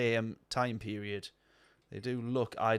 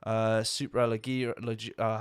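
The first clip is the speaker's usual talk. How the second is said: ordinary talk, nothing marked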